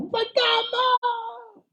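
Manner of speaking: high, drawn-out terrified shout; a sudden high wail of fear; panicked cry. Acted. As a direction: cry out as if you've seen a ghost. Disciples' panicked cry acted.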